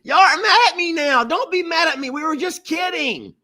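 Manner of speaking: high-pitched wail of protest; whiny, very high defensive plea; pleading. Real, not acted. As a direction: beg viewers not to be mad. Pleading with upset viewers.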